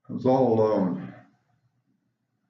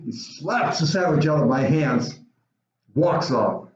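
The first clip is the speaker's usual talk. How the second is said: ordinary talk, nothing marked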